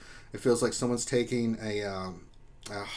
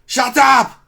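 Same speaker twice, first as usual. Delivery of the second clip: mock outburst; 10 semitones higher, 13 dB louder than usual. Mock rage at his "mortal enemy.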